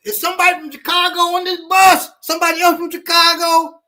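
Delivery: high, fast mock shout; calling for backup. Grits guy calling for Chicago folks on the bus, mock shout. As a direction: holler down the bus for allies. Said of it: "He said".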